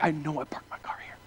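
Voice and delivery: whispered to himself in disbelief; panicked whisper. Retold or imitated. Finding his parked car gone, a stunned whisper. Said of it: "that feeling is what I had 'cause I'm like".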